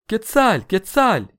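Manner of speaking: high, loud squealed cry; panicked screech. A narrator's reading. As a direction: screech the name out twice in alarm. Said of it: "верещал по всему Теночтитлану". (пронзительно верещит)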